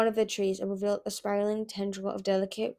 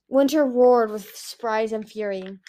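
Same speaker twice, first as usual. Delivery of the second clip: plain reading voice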